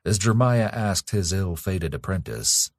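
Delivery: usual speaking voice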